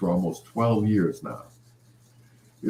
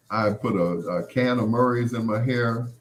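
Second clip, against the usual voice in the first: ordinary talk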